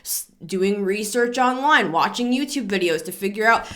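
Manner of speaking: normal talking voice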